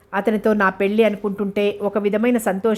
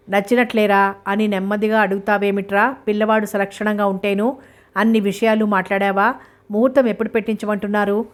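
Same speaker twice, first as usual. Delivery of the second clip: plain reading voice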